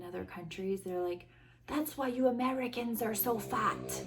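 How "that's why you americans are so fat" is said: put-on vague foreign accent, pitched up; higher, scolding voice in an unplaceable foreign accent; non-American accent. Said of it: "what accent that was that I made them".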